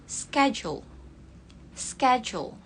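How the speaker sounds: American "schedule" with a hard k, "skedule"; American accent. Shown as a demonstration. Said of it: "C-nya jadi K gitu kan ya kalau American". (logat American)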